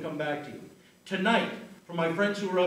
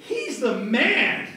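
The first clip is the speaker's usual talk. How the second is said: drawn-out, higher, louder cheer; shouted cheer; 6 semitones higher, 6 dB louder, much slower than usual. Shown as a demonstration. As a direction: shout it out like a crowd chant. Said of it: "say at the top of your lungs".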